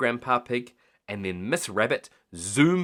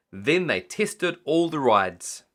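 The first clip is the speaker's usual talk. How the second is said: usual speaking voice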